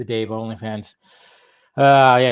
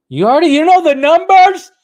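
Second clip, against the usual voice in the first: surprised; 9 dB louder than usual. Real, not acted. Host reacting to a viewer who knows the numbers, surprised and loud. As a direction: pitch jumps way up, much louder, amazed. Viewer seems to know box office.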